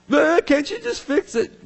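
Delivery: high, strained plea; patient begging the triage nurse for help; pleading patient. Retold or imitated. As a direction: whine at the nurse to just fix it. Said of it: "triage nurse is going".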